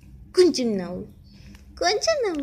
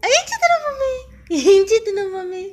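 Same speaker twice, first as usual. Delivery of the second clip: put-on high, loud cooing questions to a baby; sing-song baby talk; 9 semitones higher, 9 dB louder, faster than usual. Mother coos high to her baby, asking what she's doing. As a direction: coo up high at the little one.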